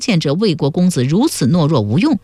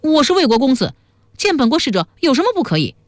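mock indignant yell; 9 semitones higher, faster than usual. A narrator's reading. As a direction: protest high as an outraged prince. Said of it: "魏汉大叫道".

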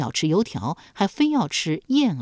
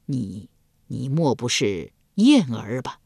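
slow, hesitant, halting guess; tentative question; 4 semitones lower than usual. Acted. Duke hesitantly asks if the fisherman is his lost love, slow. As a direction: feel your way toward the name. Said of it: "试探着问道".

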